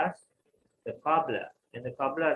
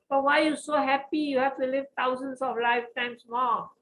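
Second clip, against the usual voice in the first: astonished question; 10 semitones higher, 4 dB louder than usual. Acted. Shocked Narada questions cobbler.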